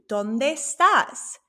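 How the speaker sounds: ¿Dónde estás? said very slowly, each syllable drawn out; slow teacher demo. Shown as a demonstration. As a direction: slowly model ¿Dónde estás? for learners to copy. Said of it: "So Marco asked".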